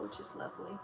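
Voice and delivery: normal talking voice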